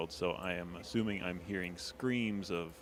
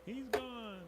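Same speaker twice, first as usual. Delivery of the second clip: high, gleeful cheer; 8 dB louder, slower than usual. Acted. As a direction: cheer it like good riddance. Character cheering with ally.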